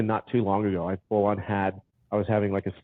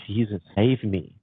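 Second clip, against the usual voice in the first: usual speaking voice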